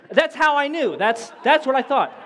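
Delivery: high, insistent sitcom line; Joey from Friends. Retold or imitated. Quoting a sitcom character to the crowd, high and insistent. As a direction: quote it high and defensive. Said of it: "where Joey's like".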